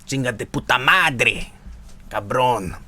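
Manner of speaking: put-on quiet, half-hearted Mexican Spanish accent; mock Spanish. [name] joking about blurting Spanish on stream, mild Mexican accent. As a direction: toss off some Spanish as a joke, lightly. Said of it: "learn Spanish".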